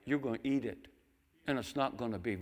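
usual speaking voice

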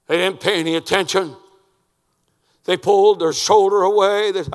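high, shouted sermon delivery; loud preacher at full pitch; full-force preaching. Real, not acted. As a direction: thunder it out to the congregation.

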